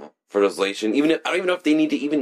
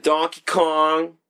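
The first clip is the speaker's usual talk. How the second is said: coy, playful teasing voice; teasing sing-song; 8 semitones higher, 3 dB louder than usual. Pretend. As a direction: dangle the secret playfully. Teasing hints about his level.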